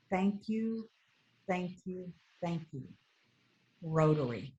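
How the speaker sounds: soft broken English. Retold or imitated. Grateful mother thanking Rotary, soft broken English. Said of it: "in her broken English".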